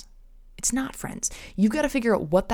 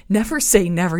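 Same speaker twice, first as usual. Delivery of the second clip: laughing repeat; 7 dB louder than usual. Shown as a demonstration. Host laughing at her own pop reference, louder.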